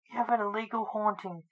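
plain reading voice

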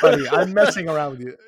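teasing laugh. Real, not acted. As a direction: laugh it off with your buddy.

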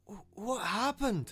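high, slow, shaken stammer; just woke up confused; dazed and stammering. Acted. Dazed after a fall.

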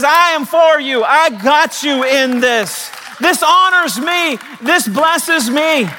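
very high, slow, emphatic declarations; God. Acted. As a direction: proclaim each phrase with triumphant force. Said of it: "the voice of God that says".

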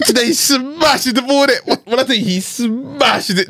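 high wheezing laughter; laughing. Real, not acted. Recounting the ball being smashed, laughing high. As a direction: laugh through the telling.

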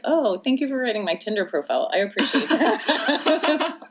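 sarcastic quip. Retold or imitated. Sarcastic reply to doctor.

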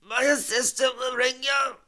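high, strained pained voice; hammed-up agony, pitched well up; in terrible pain. Injured man on the phone promising a call back, in terrible pain. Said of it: "He sounded in terrible pain".

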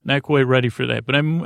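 normal talking voice

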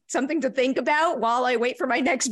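ordinary talk

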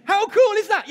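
thrilled cry of delight; excited. Real, not acted. Excited reaction to member's answer.

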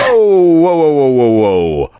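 loud drawn-out whoas sliding downward; urgent interruption. Pretend. Podcast lawyer stopping the co-host mid-claim, loud interruption. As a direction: jump in loudly to halt the story.

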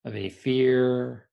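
ordinary talk, nothing marked

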